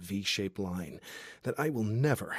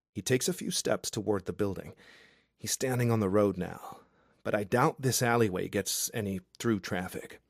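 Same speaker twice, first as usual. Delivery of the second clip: ordinary delivery, nothing marked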